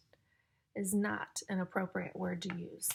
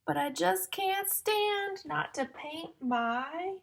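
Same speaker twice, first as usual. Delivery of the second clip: loud, slow, sing-song storybook reading; reading to children; 3 semitones higher, 6 dB louder, slower than usual. Acted. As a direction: build suspense before each rhyme. Reading rhyming picture book to children.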